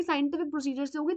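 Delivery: ordinary talk, nothing marked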